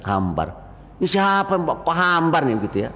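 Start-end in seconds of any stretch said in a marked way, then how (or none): 0.1-0.5 blunt verdict. Retold or imitated. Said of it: "dalam hitungan detik menyampaikan".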